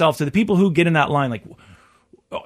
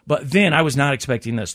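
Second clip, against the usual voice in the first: ordinary delivery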